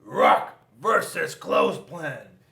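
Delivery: loud, slow battle cry; battle yell. A narrator's reading. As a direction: announce the fight at full voice. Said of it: "Rock jumped in the air and yelled".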